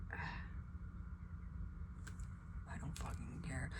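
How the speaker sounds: very soft and low, half-whispered; muttered aside. Real, not acted. Muttering to oneself about not caring, very quiet. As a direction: mutter it under the breath.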